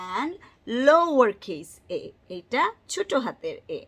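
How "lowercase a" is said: LOWERCASE said slow and loud; lowercase stressed. Shown as a demonstration. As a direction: lean hard on lowercase, drawn out. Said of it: "And this is".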